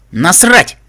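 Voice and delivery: loud, high-pitched defiant snap; curt, shouted dismissal; sharp retort. A narrator's reading. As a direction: throw it back sharply. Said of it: "резко ответила огнегривая кобыла".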